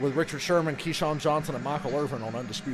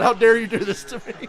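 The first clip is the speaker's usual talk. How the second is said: mock outrage; 7 semitones higher, 7 dB louder than usual. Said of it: "He said".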